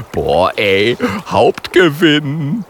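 loud, slow, drawn-out cry of glee; thrilled. Acted. Polar bear gloating over his catch, a loud gleeful cry. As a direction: relish the jackpot out loud. Björn exults over the seal.

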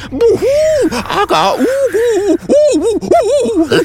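loud, high ghost hooting; frightful ghost noises. A narrator's reading. Ghost trying to frighten dragon and porcupine, spooky howling. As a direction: howl and hoot to scare them. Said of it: "gibt dabei die furchtbarsten Laute von sich".

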